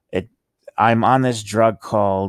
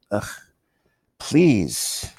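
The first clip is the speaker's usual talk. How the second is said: ordinary talk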